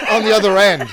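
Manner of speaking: normal talking voice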